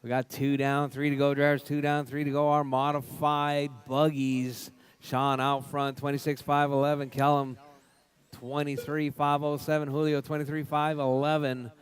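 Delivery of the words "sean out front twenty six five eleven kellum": ordinary talk, nothing marked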